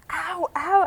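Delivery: high mock yelps of pain. Retelling the cramp on stage, a high mock yelp. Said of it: "I yelped".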